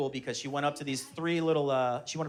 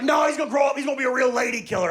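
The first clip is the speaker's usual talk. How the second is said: high, loud mock bragging; excited put-on shout; boastful proud dad; 8 semitones higher, 5 dB louder, faster than usual. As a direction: imitate a buddy hyping up his baby. Buddy keeps boasting about his baby.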